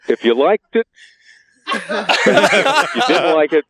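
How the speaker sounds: can't hold back the giggles after the punchline; laughing at own joke. Real, not acted.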